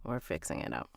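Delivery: usual speaking voice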